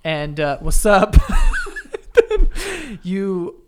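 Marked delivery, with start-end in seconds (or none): 1.2-3.0 loud high cackle; high burst of laughter. Real, not acted. Laughing at his what's up.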